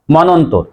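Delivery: মনন্তর said monontor, single n; common mispronunciation. Shown as a demonstration. Teacher shows what many say, mispronounced. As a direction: keep the first n single in মনন্তর. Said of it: "আমরা অনেকেই উচ্চারণ করি".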